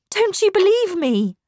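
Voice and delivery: high, loud, distressed appeal; urgent plea. A narrator's reading. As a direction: plead to be believed. Governess pleads to be believed.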